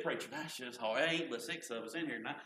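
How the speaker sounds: voicing a doubtful listener, low and soft; mock low objection. Preacher quoting a doubting member, low and soft. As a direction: grumble it like a reluctant churchgoer.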